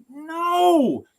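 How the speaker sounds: high, drawn-out wail of refusal; loud, long, high protest; exasperated yell. Retold or imitated. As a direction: cry it out in exasperation at the machine. Acted yell at phone menu.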